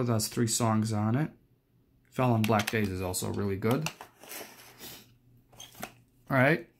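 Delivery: normal talking voice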